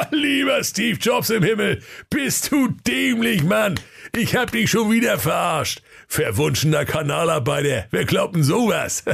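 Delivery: high, gloating workman shout; gloating sewer worker. Acted. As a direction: crow over the trick. (hämischer Kanalarbeiter)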